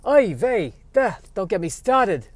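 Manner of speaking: put-on high, loud Yiddish-accented squirrel. Squirrel character kvetching.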